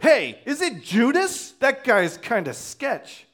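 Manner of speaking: high, loud, drawn-out mock callout; mock loud accusation. Imagined disciple calling out Judas to the others, mock loud accusation. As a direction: pipe up loudly, pretending to finger a suspect.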